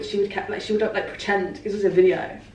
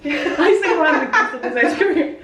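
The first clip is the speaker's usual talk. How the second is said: laughing; 7 semitones higher, slower than usual. Real, not acted. Jokes about boosting [name].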